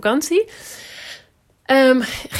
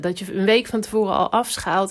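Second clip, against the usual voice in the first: ordinary delivery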